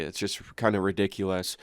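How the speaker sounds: usual speaking voice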